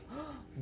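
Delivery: sharp high gasp; shocked gasp. Retold or imitated. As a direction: gasp like a startled kid. Child sees teacher in public.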